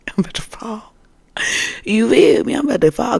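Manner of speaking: high, loud desperate plea; desperate cry. Retold or imitated. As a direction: cry out you're falling.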